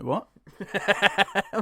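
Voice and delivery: high stretched indignant "What?"; a mock-offended what. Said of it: "And I'm like".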